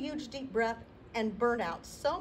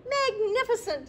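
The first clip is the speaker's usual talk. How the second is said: mock amazement; 13 semitones higher, 8 dB louder than usual. Princess cheering on dragon's fire, exaggerated delight. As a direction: gush over him to egg him on.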